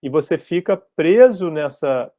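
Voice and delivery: ordinary talk, nothing marked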